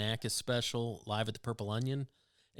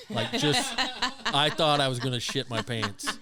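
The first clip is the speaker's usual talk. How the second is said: laughing while talking; 4 semitones higher, 4 dB louder than usual. Real, not acted. Recalling hardest laugh.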